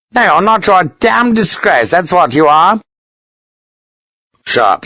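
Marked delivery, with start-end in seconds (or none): 0.1-2.8 high, indignant put-down; scornful anger. A narrator's reading. Colonel rebuking a lieutenant, scornful and angry. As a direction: denounce him in a raised pitch.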